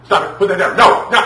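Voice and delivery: high, loud rapid-fire scolding; screaming parent demo. Acted. Parent barking orders at a disobedient child, screaming parent demo. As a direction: act out a parent losing control.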